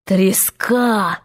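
slow, drawn-out verdict; Hook imitation. A narrator's reading. Peter answers Hook in his own voice, mock captain. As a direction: stretch the word like the captain. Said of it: "всё ещё голосом самого Крюка".

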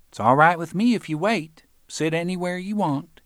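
aunt letting visitor wait, indifferent; old aunt voice. Acted. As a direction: play the easygoing old aunt.

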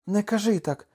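high, urgent plea; wife's high-pitched protest; pleading protest. Acted. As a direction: cut in high to stop him. Wife protests his despair.

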